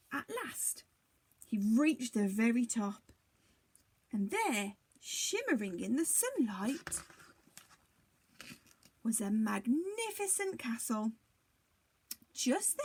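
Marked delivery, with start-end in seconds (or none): none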